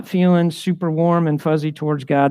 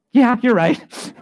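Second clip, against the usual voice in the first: laughing agreement; 8 semitones higher, slower than usual. Retold or imitated. Agreeing with wife's jab.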